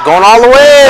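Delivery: excited call. Real, not acted. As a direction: call the drive with energy. Drive to the basket called live.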